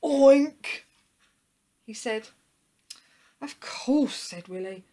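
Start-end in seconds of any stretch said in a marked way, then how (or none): 0.0-0.8 high, loud single oink; short pig-noise word. A narrator's reading. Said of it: "The pig looked at him". 3.4-4.2 high, louder 'of course'; eager storybook dog outburst; delighted realisation. A narrator's reading. Willie suddenly remembers the answer.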